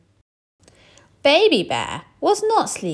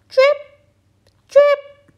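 very high, louder drip; dripping tap; 11 dB louder than usual. Acted. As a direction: plink like water drops. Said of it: "went the leaky kitchen tap".

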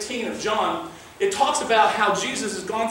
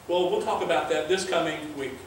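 usual speaking voice

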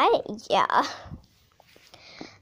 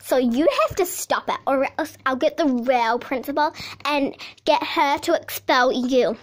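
ordinary talk, nothing marked

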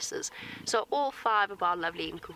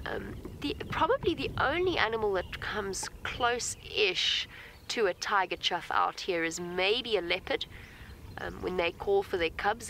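ordinary talk

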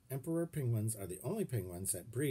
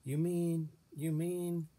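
higher, nervous stammer repeating you mean; 7 semitones higher than usual. A narrator's reading. Uncle asking Miss Frizzle if he must become a penguin, stammering. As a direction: stumble over the words, anxious. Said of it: "Uncle Cecil stammered".

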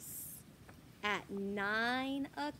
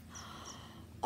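gasp; 11 dB quieter than usual. Acted. Reader reacts to Max.

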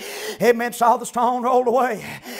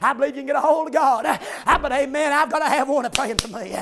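usual speaking voice

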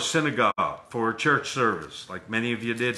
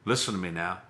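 ordinary delivery

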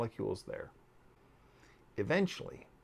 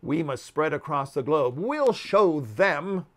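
scheming insect vow; 2 semitones higher, 8 dB louder than usual. Acted. Insects plotting to rule the world, loud and menacing. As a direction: plot revenge with grim glee.

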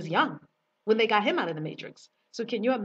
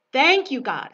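joyful thanks; 5 semitones higher, 11 dB louder than usual. Retold or imitated. Retelling her joyful prayer to God.

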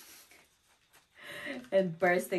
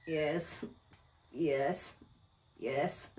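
imitated tiredness; 3 semitones lower, 4 dB quieter, much slower than usual. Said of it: "three in the morning and you're tired".

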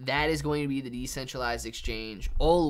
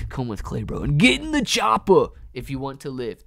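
Terminator impression; 10 dB louder than usual. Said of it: "my impressions ... are the worst impressions ever".

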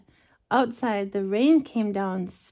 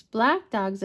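usual speaking voice

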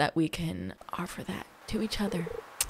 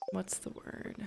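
hushed whisper while searching for a word; whispered aside; 15 dB quieter than usual. Real, not acted. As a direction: breathe it like a secret.

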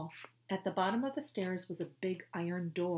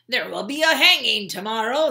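gloating guard; 5 semitones higher, 16 dB louder than usual. Acted. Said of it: "said Mustache".

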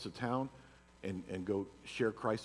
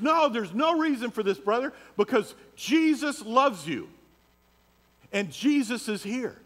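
high, loud, slow earnest appeal; Scott preaching; 12 semitones higher, 8 dB louder, slower than usual. Retold or imitated. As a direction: plead calmly but loudly with the thug. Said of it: "he goes".

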